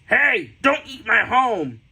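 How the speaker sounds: loud, high cartoon worm yell. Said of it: "this little worm is yelling at Farrah".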